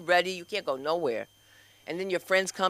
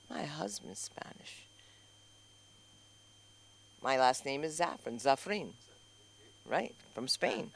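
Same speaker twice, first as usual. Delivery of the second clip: confiding whisper; 2 semitones lower, 10 dB quieter than usual. Retold or imitated. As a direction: lean over and whisper a secret. Whispering to nearby ballet dancers.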